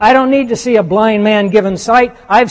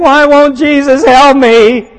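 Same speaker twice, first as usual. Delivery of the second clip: slow, loud, higher mock whine; whiny complaint; 5 semitones higher, 7 dB louder, slower than usual. Mocking a blind believer's complaint to Jesus, slow loud whine. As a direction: plead like a self-pitying believer.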